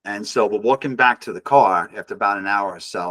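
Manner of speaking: ordinary talk, nothing marked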